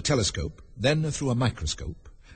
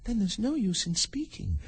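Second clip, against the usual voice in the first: put-on high, soft girl's voice; light, high inner voice of Alice; Alice thinking; 10 semitones higher, 4 dB quieter than usual. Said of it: "Alice thought to herself".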